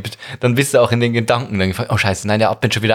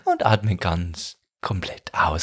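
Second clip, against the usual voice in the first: guided meditation voice; 5 semitones lower, 7 dB quieter, slower than usual. Retold or imitated. Meditation cue, slow and soft.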